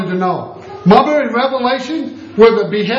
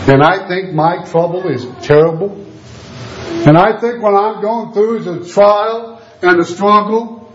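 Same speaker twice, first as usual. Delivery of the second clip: usual speaking voice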